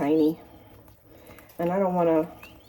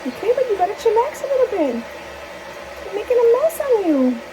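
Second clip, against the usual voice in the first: much higher, louder mock-scolding coo; 5 dB louder than usual. Real, not acted. Owner teasing her messy bird in a high baby voice.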